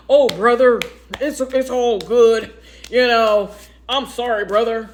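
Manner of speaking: half-mocking the father's slow backpedaling apology; apologetic man. The other father apologizes to her ex, mock placating. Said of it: "He trying".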